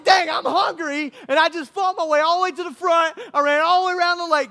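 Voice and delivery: high, loud, hammed-up complaint; shouted, put-on childish indignation; protesting kid. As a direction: wail in protest like a hungry kid. Imagined kid protests about his lunch.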